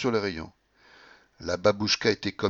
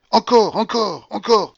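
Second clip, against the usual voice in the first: high, loud, repeated urging; insistent demand; 10 semitones higher, 7 dB louder than usual. A narrator's reading. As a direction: demand another go feverishly. Gambler demanding another bet after losing.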